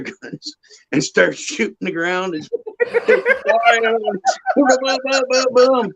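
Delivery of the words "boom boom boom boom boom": high, rapid vocal gunshots; imitated gunfire. Storyteller imitating buddies shooting the ground, high gunshot booms. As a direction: mimic a burst of pistol fire.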